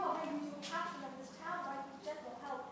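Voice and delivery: usual speaking voice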